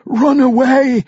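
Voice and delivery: high, drawn-out yell; long wailing warning; desperate shout. A narrator's reading. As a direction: cry out with the last of your strength. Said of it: "shouted Tanner Britches".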